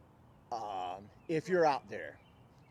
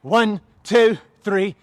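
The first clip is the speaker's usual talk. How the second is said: loud drill-style cadence; shouted count; 4 semitones higher, 14 dB louder, slower than usual. Real, not acted. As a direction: shout the numbers in steady rhythm. Trainer calls out exercise count.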